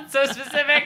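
high, laughing through the words. Real, not acted. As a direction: crack up mid-sentence. Laughing at a friend's answer.

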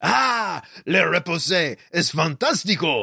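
hammy fake French flourish; put-on European accent, mixed up; mock French accent. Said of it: "Still not French".

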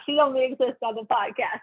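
normal talking voice

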